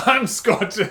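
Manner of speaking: put-on loud, high echo in a Scottish accent; mock Scottish accent. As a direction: parrot it back in exaggerated Scottish. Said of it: "doing a Scottish accent".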